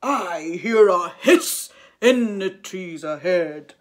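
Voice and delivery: loud, slow monster growl; a big hungry beast; Gruffalo voice. A narrator's reading. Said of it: "the Gruffalo said".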